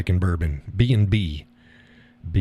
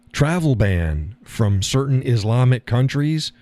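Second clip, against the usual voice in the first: usual speaking voice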